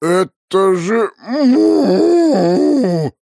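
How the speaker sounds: slow, high, loud mooing; drawn-out cow moo. Acted. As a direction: stretch the word into a moo. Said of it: "бубнил босс".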